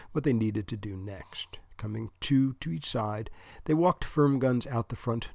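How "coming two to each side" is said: plain reading voice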